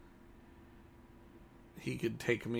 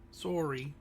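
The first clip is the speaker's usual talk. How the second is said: Canadian "Sorry" with a rounded "sore" vowel; jokey Canadian-accented apology; 3 semitones higher, 3 dB quieter than usual. Shown as a demonstration. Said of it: "sound Canadian there for a second".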